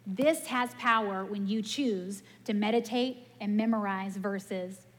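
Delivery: usual speaking voice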